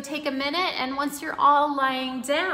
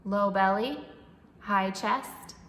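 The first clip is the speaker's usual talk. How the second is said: calm, even breathing-coach voice; guided meditation; 8 dB quieter than usual. Acted. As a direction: guide the breath gently and steadily.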